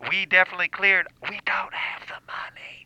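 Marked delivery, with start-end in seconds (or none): 1.3-2.9 hushed secret whisper. Acted. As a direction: whisper so the guest can't hear. Secret aside off-air.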